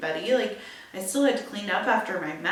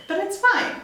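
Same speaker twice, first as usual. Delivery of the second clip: breezy it's fine; 11 semitones higher, 6 dB louder than usual. Real, not acted. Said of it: "brushing off after horrific".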